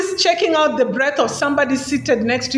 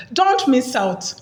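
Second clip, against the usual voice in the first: ordinary delivery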